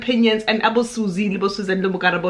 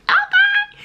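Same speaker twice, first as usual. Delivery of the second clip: very high, mock-sweet squeak; comic falsetto sign-off; squeaky okay; 4 dB louder than usual. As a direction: jump way up into a tiny falsetto.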